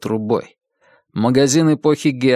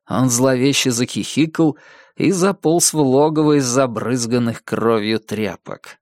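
usual speaking voice

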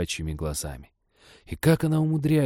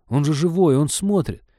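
anguished protest; 7 semitones higher, 4 dB louder than usual. Acted.

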